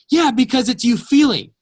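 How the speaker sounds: retold tearful cry, pitched high and loud; tearful outburst. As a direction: plead through tears, reliving it. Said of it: "bawling my eyes out".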